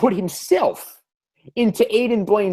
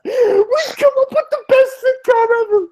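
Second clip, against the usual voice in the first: very high, squeaky laughing voice; helpless laughter; 3 dB louder, much faster than usual. Real, not acted. Podcaster delighted by their sitcom idea, helplessly laughing. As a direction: wheeze it out quickly, overcome with laughter.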